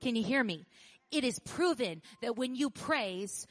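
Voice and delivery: loud, slow, climbing preacher's call; full-force preaching. Acted. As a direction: build the sermon to a shouting peak.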